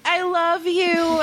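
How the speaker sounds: slow, high, mock-tearful; drawn-out hammed-up declaration through fake tears; imitated sad pleading. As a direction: act the heartbroken boyfriend. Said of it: "he's so sad".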